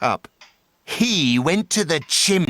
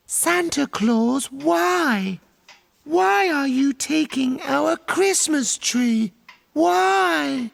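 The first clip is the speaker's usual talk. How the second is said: put-on much higher, small child's pleading voice; little girl voice; 9 semitones higher than usual. A narrator's reading. Cindy Lou Who asking the Grinch why, in a small high voice. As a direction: ask like a tiny girl who doesn't understand. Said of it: "She stared at the Grinch and said".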